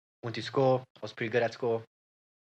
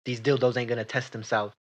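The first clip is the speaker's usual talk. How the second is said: stern boss; 6 dB louder than usual. Acted. Boss scolding employee.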